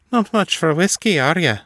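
light Irish lilt, teasing; teasing Irish accent. Acted. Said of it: "Esme observed".

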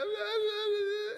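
high, loud mock crying wail; mock child's pained cry; imitated crying scream. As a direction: wail in pain like a child, hammed up. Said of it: "he's like".

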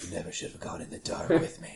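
very low, hushed mock menace; Vin Diesel impression. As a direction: play a soft, low action-movie psychopath. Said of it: "go up Vin Diesel and be like".

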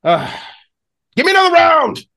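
ending in a high, loud shout; shrug, then bellow for drinks; giant calls for a round. Acted. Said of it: "the half giant go like".

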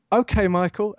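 high, bright casual okay; breezy star-struck reply. Retold or imitated. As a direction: act as if it's totally normal.

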